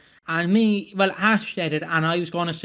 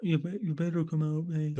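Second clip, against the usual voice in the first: softer echo in mock Australian; mock Australian accent; 8 dB quieter than usual. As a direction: parody a Brummie turned Australian. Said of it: "It's just turn Australian".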